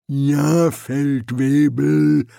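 loud, slow, rumbling reply; growling troll. A narrator's reading. Troll answering the sergeant, slow growling rumble. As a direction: growl it grudgingly. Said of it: "grollte der Troll".

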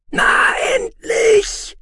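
very high, drawn-out shriek; shrill, loud inner-voice screech. A narrator's reading. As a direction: screech it slowly at the top of the voice. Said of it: "kreischte es in seinem Inneren".

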